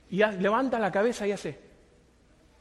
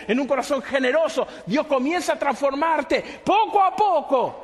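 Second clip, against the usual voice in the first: raised, driving sermon delivery; forceful preaching; 6 semitones higher, 5 dB louder than usual. Real, not acted. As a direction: press the point home to the congregation. (predicación enérgica, voz alzada)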